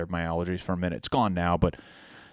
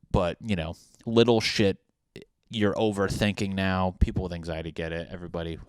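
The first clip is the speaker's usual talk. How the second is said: ordinary talk, nothing marked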